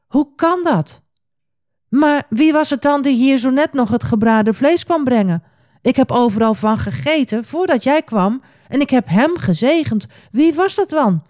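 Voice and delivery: high-pitched, agitated questioning; shocked, voice jumping up in alarm. A narrator's reading. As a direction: demand answers in startled dismay. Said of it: "begon te beven van schrik".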